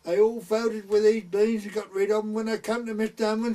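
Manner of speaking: lightly imitated gossiping neighbour; woman's voice. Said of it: "she said".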